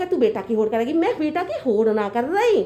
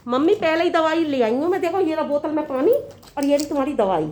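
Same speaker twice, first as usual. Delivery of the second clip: usual speaking voice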